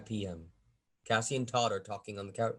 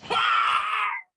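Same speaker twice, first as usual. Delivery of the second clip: scream; 10 dB louder than usual. Acted. Cassie discovers Todd stabbed.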